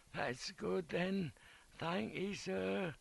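high, soft, fading invalid's voice; croaked little whisper. A narrator's reading. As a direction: thank the officer faintly from a sickbed. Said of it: "croaked out in a little whisper".

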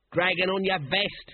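high, mild mock shout; shouted jeer. A narrator's reading. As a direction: call it after him as a taunt. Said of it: "he shouted".

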